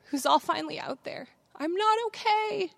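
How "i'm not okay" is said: high-pitched release of a secret; confession let out. Retold or imitated. Speaker recalls her secret finally out, high-pitched release.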